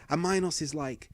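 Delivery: ordinary talk, nothing marked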